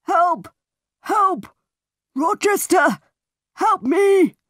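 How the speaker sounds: high, loud desperate cries for help; shouting for help. Acted. Wounded man shouting for Rochester in the night, loud desperate cries. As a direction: scream for help down the corridor. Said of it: "I heard somebody shouting".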